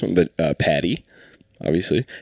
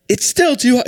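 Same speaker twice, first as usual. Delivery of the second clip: much higher squeaky mock voice; high mock cartoonist; 5 dB louder than usual. Cartoonist complaining about Lola Bunny, high mocking squeak. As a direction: whine it way up like a fussy animator. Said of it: "he'd be like".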